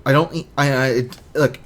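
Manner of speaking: normal talking voice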